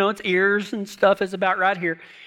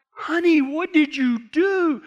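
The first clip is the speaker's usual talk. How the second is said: dismayed disbelief; 7 semitones higher than usual. Retold or imitated. Husband to wife about the emaciated horse, dismayed.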